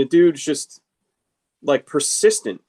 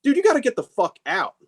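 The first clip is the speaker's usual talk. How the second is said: retold exasperated outburst; 8 semitones higher than usual. Retold telling off persistent client.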